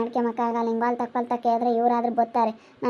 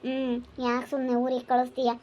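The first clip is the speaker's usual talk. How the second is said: usual speaking voice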